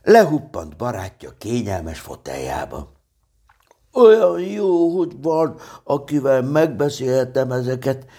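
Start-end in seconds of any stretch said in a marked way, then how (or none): 3.9-8.1 rejoice in a high bear voice; delighted. A narrator's reading. Pooh to his friend Piglet, delighted. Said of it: "örvendezett Mackó".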